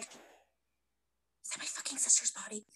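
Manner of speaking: slow, slow horrified whisper. Retold or imitated. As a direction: breathe it out in disbelief. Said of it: "she's just like".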